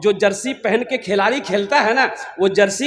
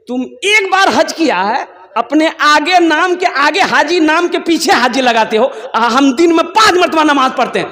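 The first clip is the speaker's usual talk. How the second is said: higher-pitched, emphatic comeback; indignant retort; 7 semitones higher, 4 dB louder than usual. Acted. Worshipper arguing with the Haji over titles, indignant retort. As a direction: throw it back in acted indignation.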